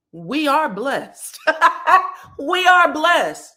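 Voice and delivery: loud, high, laughing through a hammed-up blessing; laughing mock praise. Mocking Cecil's piety.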